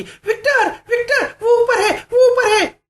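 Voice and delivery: shrill-pitched panicked scream for help. Acted. Martha screaming to her husband that it is upstairs, panicked scream. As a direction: scream it out, very high. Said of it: "Martha अचानक चीखी".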